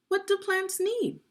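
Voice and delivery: What do plants need, pitched high with do stressed; high question. Shown as a demonstration. Teacher repeating the title to children, pitched high.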